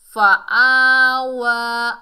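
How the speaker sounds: loud, very slow drawn-out chant; Quran recitation. Shown as a demonstration. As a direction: stretch the word long and full. Verse ending recited.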